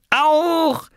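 put-on very high toddler squeal; delighted shriek. Lars tugging at Oskar's sheet, delighted shriek. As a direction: squeal with sheer excitement. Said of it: "schrie er und riss an Oskars Bettlaken".

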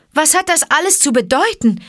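high-pitched, confused question; bewildered. Acted. Mowgli to Akela as stones rain down, bewildered. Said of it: "fragte Mowgli ganz verwirrt".

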